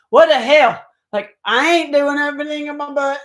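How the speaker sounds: hammed-up indignant refusal; indignant outburst. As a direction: push back like a loud rebel, retold. Retelling her rebellious reply to beings.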